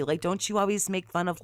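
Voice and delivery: normal talking voice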